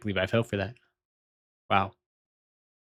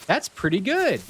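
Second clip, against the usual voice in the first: pitched way up, mock-gracious praise; amused gent voice; 8 semitones higher than usual. Streamer to chat, praising the factorial joke in a high put-on gent voice. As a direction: play a delighted old gent conceding the joke.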